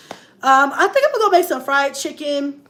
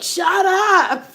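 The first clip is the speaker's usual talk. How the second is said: imitating girl; 8 semitones higher, 5 dB louder than usual. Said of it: "She was like".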